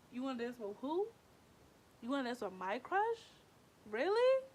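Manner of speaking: questioning in disbelief, pitch climbing to a high "really"; rising, offended double-check; mild incredulity. Retold or imitated. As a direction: act out mild disbelief, building. Disbelief at friend dancing with crush.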